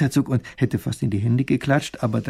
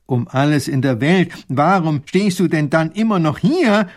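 louder, slowed disbelief; exasperated outburst; 4 semitones higher, 7 dB louder than usual. Acted. Professor bursts out at the soldier in exasperated disbelief. Kann nicht mehr an sich halten.

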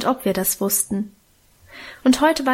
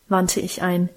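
ordinary delivery

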